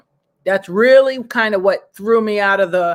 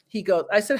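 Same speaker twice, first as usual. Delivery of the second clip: ordinary delivery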